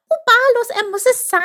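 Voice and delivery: very high, loud squeak of awe; hushed awe, unwhispered. A narrator's reading. Chip realises who the child is, high awed squeak. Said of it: "flüsterte Chip".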